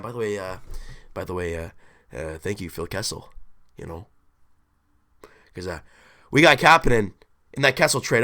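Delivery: ordinary delivery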